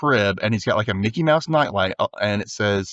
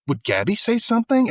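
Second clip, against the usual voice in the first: higher, suddenly suspicious question; suspicious realization; 11 semitones higher than usual. Retold or imitated. Alex wondering about Gabby, higher suspicious question. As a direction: realize it could be her.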